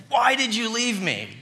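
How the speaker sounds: put-on a boy scolding his dad in the driveway; child's reprimand. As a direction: scold Dad like a frightened seven-year-old. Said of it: "And I reprimanded him".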